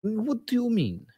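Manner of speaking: a meek traveller asking the librarian for maps; polite newcomer character. Acted. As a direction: play the lost foreigner, timid and polite. Plays his character.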